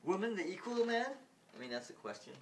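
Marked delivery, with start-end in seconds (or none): 0.0-1.1 much higher, loud disbelief; incredulous ruler. Retold or imitated. Ruler scoffs at women being equal, high and loud. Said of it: "Then the ruler goes".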